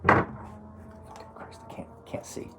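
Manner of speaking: breathy whisper to himself, squinting; whispered frustration. Real, not acted. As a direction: mutter under the breath, annoyed. Squinting at a number.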